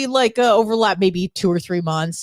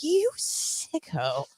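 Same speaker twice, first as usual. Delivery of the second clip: drawn-out, higher, softer teasing sneer; slow mock disgust; 7 semitones higher, 8 dB quieter, much slower than usual. Imitating the cohost zooming in, slow soft teasing. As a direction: stretch it out like a sly jab.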